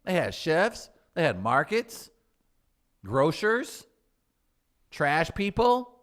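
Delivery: high, loud exasperated listing; loud high rant. Acted. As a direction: shout the list in outrage. Indignant rant, raised voice.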